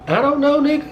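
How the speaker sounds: jumps high, sudden playing dumb; high, sharp mock innocence; defensive denial. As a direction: deny it fast and high, caught out.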